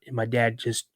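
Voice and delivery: ordinary delivery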